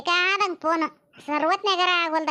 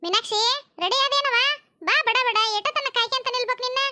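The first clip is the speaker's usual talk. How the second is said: calling out; 7 semitones higher than usual. Acted. Mother calls Meenakshi to hurry and come, high calling voice. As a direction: hurry her up from across the house.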